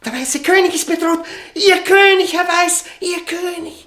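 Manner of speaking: very high, loud panicked warning; frantic shout to Herr Weiß; alarmed shout. Acted. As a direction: cry out the threat to the king. Prisoner warning imagined player.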